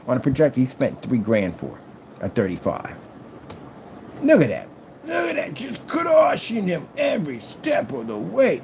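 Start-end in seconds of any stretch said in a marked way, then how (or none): 4.2-8.6 high, slow, gloating shout. Real, not acted. Gloating to the viewers over crushing the rival, high and slow. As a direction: crow over the result, stretched out.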